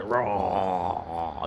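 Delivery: long, low mock roar sinking away; imitated dying roar. Gamer acting out the monster's death, mock dying roar. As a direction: let a fake monster roar fall and die. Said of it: "he died".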